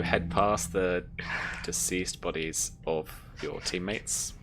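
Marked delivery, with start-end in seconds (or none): none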